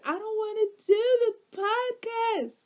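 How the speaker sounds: put-on high, whining childish no; whiny refusal. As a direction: whine it like a sulky kid. Quoting own refusal.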